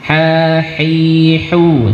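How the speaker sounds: "Ha, hi, hu" light and airy; thin ha drill. Shown as a demonstration. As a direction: breathe each ha lightly. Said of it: "Coba tirukan". (latihan ha tipis)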